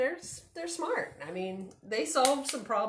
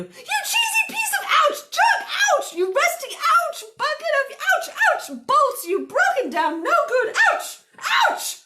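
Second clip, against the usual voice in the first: very high, loud comic tirade; rant, broken by pained yelps; hammed-up storybook fury under attack; frantic cursing; 8 dB louder than usual. A narrator's reading. Cursing tractor while being pecked.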